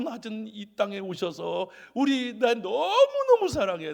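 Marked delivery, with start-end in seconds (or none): 1.9-3.9 loud, high declaration of love; gushing love. Retold or imitated. God come down to tell us of love, high gushing voice. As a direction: draw out 너무너무 high and loud.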